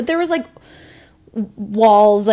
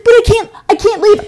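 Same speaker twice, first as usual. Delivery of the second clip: much higher, louder whining; mocking the date begging to stay; whiny mock plea; 11 semitones higher, 8 dB louder than usual. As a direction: whine like a stranded man.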